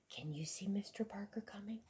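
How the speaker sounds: hushed whisper to the listeners. A narrator's reading. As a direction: whisper it like a secret. Reader whispers to children.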